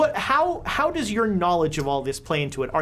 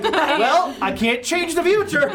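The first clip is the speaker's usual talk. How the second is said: raised, laughing mock protest; mock character quote; 7 semitones higher, 4 dB louder, slower than usual. Game master teasing a player about changing the future, mock protest. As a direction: play the line higher, through laughter. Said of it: "She's like".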